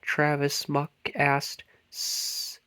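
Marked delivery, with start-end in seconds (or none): 1.9-2.6 soft unvoiced snore; sleepy hiss. A narrator's reading. Travis snoring fast asleep in the shed. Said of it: "Travis replied, fast asleep".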